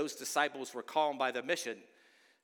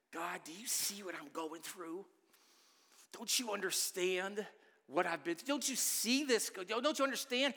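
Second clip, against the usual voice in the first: pleading, rising higher and more strained; hammed-up anguished plea, climbing to a high cry; mock desperate prayer; 5 semitones higher than usual. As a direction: cry out to God like someone at the end of their rope. Congregant voiced pleading with God.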